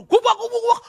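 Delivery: loud laughing thwack sounds; hammed-up beating noise, high and loud; mock beating noise. Preacher mimics father hitting child, mock loud beating sounds.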